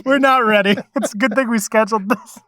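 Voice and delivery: high, loud, amused; cracking up as you say it; laughing while talking. Real, not acted. Laughing at chaotic end.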